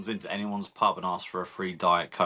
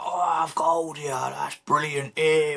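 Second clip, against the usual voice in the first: imitating Charles Bronson; 7 semitones higher, slower than usual. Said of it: "he's like".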